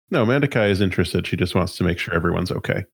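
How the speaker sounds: ordinary talk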